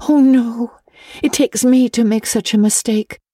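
put-on high, plaintive girl's voice; forlorn. A narrator's reading. Anne blames herself to Mrs Allen, forlorn. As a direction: lament it pitifully, pitched high. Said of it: "said Anne forlornly".

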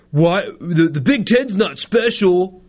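slow, sniffy mock indignation; mocking huffy voice. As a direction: play them as a prissy offended critic. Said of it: "but more like".

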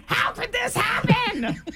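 high, slow, half-laughing outburst; exasperated mom yell. Retold or imitated. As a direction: yell at the kid in disbelief. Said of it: "But what you really say is".

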